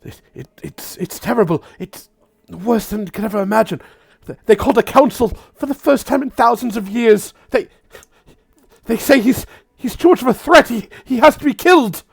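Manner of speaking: high, stammering, rushed panic; panicking. Acted. Frightened Shatterstone warning the party of a threat, panicking. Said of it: "she's panicking".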